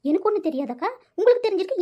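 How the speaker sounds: usual speaking voice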